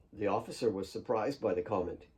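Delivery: usual speaking voice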